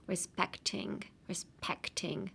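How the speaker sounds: respecting said slowly and softly, each syllable clear; pronunciation drill. Shown as a demonstration. Teacher drilling learners on respecting, slow and soft. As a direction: model respecting quietly and carefully. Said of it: "hard to pronounce".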